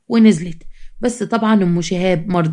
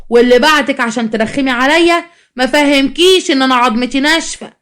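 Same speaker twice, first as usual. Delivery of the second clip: sarcastic confrontation; 4 semitones higher than usual. Acted. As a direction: take her on with mock endearment and raised voice. Stall owner confronts the shouting customer.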